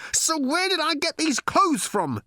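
very high, anguished howl. A narrator's reading. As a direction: wail it at the top of your range. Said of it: "he howled".

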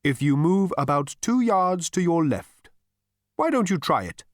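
high, loud ethereal calling; lifted voice. A narrator's reading. Voice directing Zaphod down the building, lifted high. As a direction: raise the voice airily to advise. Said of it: "the voice lifted".

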